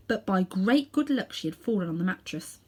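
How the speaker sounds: ordinary delivery, nothing marked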